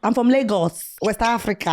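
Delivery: proudly announced in a put-on Nigerian accent; worst Nigerian accent. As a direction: lay the Nigerian accent on thick. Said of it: "I put on the worst Nigerian accent".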